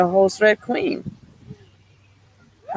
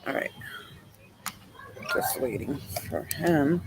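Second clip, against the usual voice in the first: whispered aside; 4 semitones lower, 13 dB quieter than usual. Real, not acted. Aside while waiting off mic.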